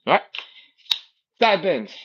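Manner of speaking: ordinary talk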